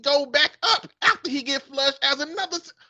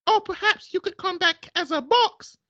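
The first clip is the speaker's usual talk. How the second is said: put-on woman's British accent; 6 semitones higher than usual. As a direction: purr it back like a smitten English lady. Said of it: "man and a woman accent".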